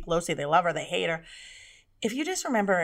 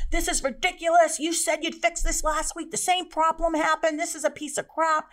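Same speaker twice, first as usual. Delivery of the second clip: high, indignant complaint; irate screaming customer; 9 semitones higher than usual. Acted. Angry customer complains to customer service, high irate rant. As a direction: rant in a raised, high-pitched voice. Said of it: "they're screaming at you".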